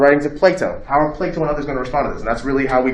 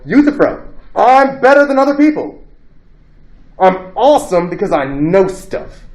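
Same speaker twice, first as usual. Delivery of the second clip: mock-pompous boast, pitched much higher and louder; smug arrogance; 6 semitones higher, 4 dB louder than usual. Teacher mocking Euthyphro to the class, smug boast. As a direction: play a self-satisfied know-it-all.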